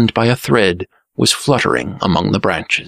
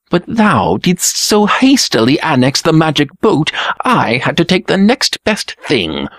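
lightly voiced prince, pitched higher; Green Prince protesting; 7 semitones higher than usual. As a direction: protest in a high voice, mildly put out. Green Prince protests to his brother.

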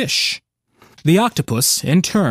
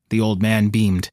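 plain reading voice

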